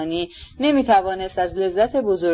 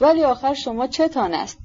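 higher-pitched, alarmed question; 6 semitones higher than usual. A narrator's reading. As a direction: ask with mounting dread. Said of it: "سخت به وحشت افتاده بود". (با نگرانی و وحشت، صدای زیرتر)

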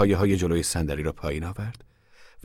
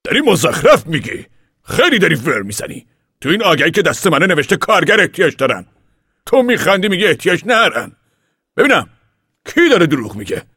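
much higher and louder, furious; angry; 12 semitones higher, 10 dB louder than usual. Acted. Papa to the ragged man, furious and loud. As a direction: shout him down in anger. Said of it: "پاپا با خشم گفت".

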